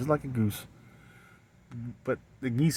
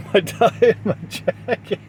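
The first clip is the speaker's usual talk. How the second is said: higher, laughing through the goose voice; 8 semitones higher than usual. Real, not acted. Vlogger cracking up mid goose voice, laughing. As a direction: giggle it out.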